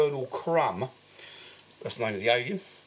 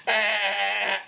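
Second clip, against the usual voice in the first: a short high "Ah" imitating a gun; mock gunfire cry; 7 semitones higher than usual. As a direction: fake a gunshot sound with your voice. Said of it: "shaking a gun and going".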